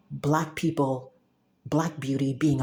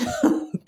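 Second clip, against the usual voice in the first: laugh. Real, not acted. Laughs after bragging.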